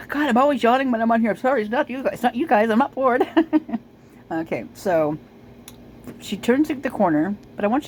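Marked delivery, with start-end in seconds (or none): 0.1-3.3 high, lively quoting voice; imitating a guy on stream. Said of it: "And he does". 3.3-3.8 short laugh. Real, not acted. Laughing after his impression.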